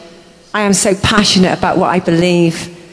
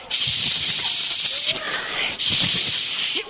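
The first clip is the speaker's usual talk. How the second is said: hushing. Real, not acted. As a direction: hush them, breathy and long. Hushing before angel moment.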